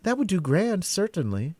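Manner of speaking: put-on high girlish voice, thoughtful; musing it over as a young girl; lightly voiced thoughtful girl; thoughtful Alice. A narrator's reading. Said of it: "said Alice thoughtfully".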